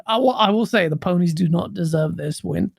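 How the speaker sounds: normal talking voice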